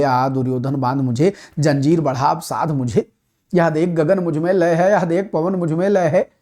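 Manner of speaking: a lightly declaimed heroic verse; poem recitation. Acted. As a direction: recite it like a stage poet. Recites Dinkar's Rashmirathi verse.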